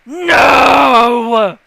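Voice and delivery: huge, drawn-out high bellow; long, full-force shouted refusal; ghostly shout. Acted. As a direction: roar it out like a furious house. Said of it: "you just hear".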